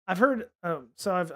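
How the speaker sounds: ordinary delivery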